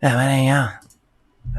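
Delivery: a broad, slurred Australian bogan greeting; Aussie bogan slur. Shown as a demonstration. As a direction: mash "Yeah, mate, how you going?" into one lazy Aussie bogan drawl. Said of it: "I'll say it a bit louder so you can hear it".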